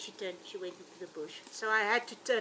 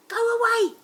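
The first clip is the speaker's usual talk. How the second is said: retold yell; 14 semitones higher, 14 dB louder than usual. Guide re-enacting chasing off an elephant cow, retold yell. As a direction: re-enact a loud, high-pitched shout. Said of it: "I yelled at her".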